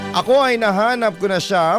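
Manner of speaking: ordinary delivery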